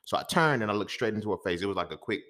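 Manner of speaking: ordinary talk, nothing marked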